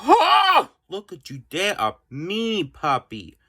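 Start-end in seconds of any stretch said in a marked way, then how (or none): 0.1-0.7 sharp high gasp, then a loud Oh; shocked gasp. Real, not acted. Kid discovering what the puppy chewed, gasping loudly. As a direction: gasp as if you just found the mess.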